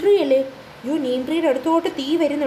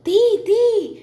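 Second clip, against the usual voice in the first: higher and louder alarm; crying fire; 7 semitones higher, 5 dB louder, much slower than usual. Acted. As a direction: call out the danger.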